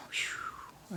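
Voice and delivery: soft whispered whew of relief; relieved whisper to viewers. Real, not acted. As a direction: breathe it out in quiet relief. Relief at better magnifier.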